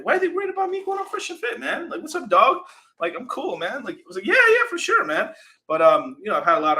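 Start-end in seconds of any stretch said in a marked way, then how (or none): 0.0-3.8 high-pitched imitation of a baffled friend; high, quick mock protest; mock incredulous. Imitates Richard's incredulous reaction. 4.3-5.3 very high, loud placating yeah; high-pitched retold reassurance; mock agreement.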